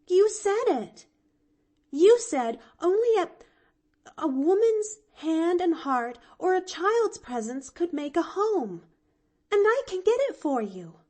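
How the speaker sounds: put-on high, loud, earnest young girl; child sure of her plan; eager little girl. A narrator's reading. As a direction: press the idea excitedly. Pollyanna explaining her plan.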